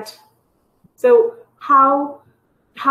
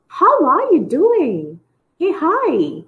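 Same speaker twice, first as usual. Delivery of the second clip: bright, high, slow, eager "How are you doing? Hey, hi."; excited greeting; 8 semitones higher, slower than usual. Shown as a demonstration. Teacher demos an excited, high greeting to students. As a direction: greet a friend you're thrilled to see. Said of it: "look at the body language of the intonation".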